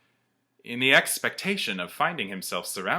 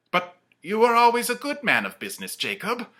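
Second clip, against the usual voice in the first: high, fast, unsteady protest; faltering; 10 semitones higher, 7 dB louder than usual. Acted. Scrooge falters defending Marley, high and hurried. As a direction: stumble over the reassurance. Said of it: "faltered Scrooge".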